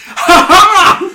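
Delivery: high, loud villain's "ha ha"; mock-evil laugh of triumph; triumphant cackle. Clone pops out mid-match, cackling triumphantly.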